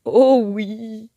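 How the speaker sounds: the smitten parrot answers his captor; lovestruck stammer. A narrator's reading. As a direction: stammer it, besotted. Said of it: "bafouille Honoré".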